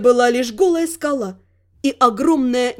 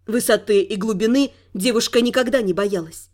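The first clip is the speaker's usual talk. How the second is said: usual speaking voice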